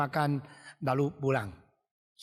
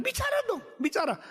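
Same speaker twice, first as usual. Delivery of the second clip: high, insistent prodding; urging father; faster than usual. Acted. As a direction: push a hesitant child to speak up. Father urges child to speak.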